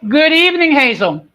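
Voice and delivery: big booming hello to the guest; loud host greeting. Real, not acted. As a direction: greet her loud like opening a show. Host greets guest on live show.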